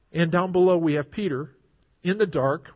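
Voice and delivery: ordinary delivery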